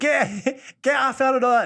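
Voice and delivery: high mock yell of a little girl; imitating a shouting kid. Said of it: "And she's shouting".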